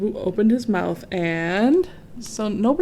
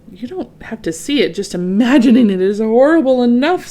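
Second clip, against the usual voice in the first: louder, rising warning; elephant warning; 4 semitones higher, 7 dB louder than usual. A narrator's reading. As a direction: scare him off the idea. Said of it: "said an elephant".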